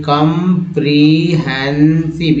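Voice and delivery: Comprehensive broken slowly into four separate parts; split into parts. Shown as a demonstration. Teacher breaks a word into parts for learners, comprehensive slow and segmented. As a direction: say comprehensive piece by piece, drawing out each part. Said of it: "ये चारों part अलग अलग करके".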